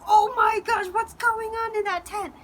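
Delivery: high, loud mock alarm; alarmed onlookers. People outside hearing screams from the tent, alarmed. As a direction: exclaim it like a startled crowd. Bystanders react to her screaming.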